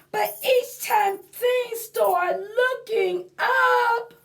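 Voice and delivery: high, slow, whiny pumpkin telling its woes; storybook lament, high and drawn out; sad pumpkin voice. Acted. Reads picture book in sung-out pumpkin voice.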